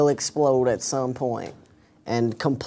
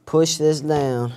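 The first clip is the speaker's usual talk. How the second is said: normal talking voice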